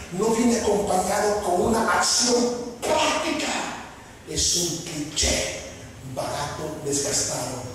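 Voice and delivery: slow, deliberate restatement; said slowly. Shown as a demonstration. Preacher repeating his point slowly for the pastors. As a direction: spell it out slowly so it lands. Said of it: "Permíteme decirlo más despacio".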